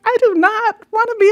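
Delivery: put-on high, loud, fast childish protest; a crying kid refusing, retold; upset child. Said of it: "I was like".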